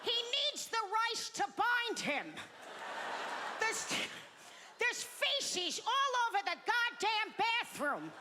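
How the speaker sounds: very high mock grandma voice; overbearing grandmother. Grandma orders for grandpa at restaurant, mock overbearing granny. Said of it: "my grandma would be like".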